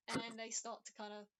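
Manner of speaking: normal talking voice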